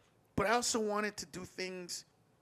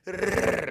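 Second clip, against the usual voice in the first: rolled rrr imitating a push mower's blades; mower noise; 3 semitones higher, 9 dB louder than usual. Imitating the manual mower spinning for the friend. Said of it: "rrr imitating mower spinning".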